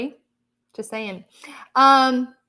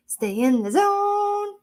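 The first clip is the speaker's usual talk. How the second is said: slow, much higher, drawn-out "Stay in the zone"; slow emphatic; 9 semitones higher, much slower than usual. Shown as a demonstration. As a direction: stretch every word of "Stay in the zone" like a mantra, slow and high. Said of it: "you stay in the zone".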